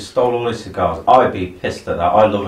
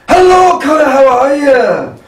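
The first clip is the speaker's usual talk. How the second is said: Billy Connolly impression; 8 dB louder than usual. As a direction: do Billy Connolly telling a yarn. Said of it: "the one person they should put in these films is Billy Connolly".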